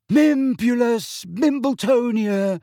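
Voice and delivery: high, slow, grand unveiling; proud. A narrator's reading. Neville shows off his odd cactus, proud. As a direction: announce the name with great pride. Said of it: "he said proudly".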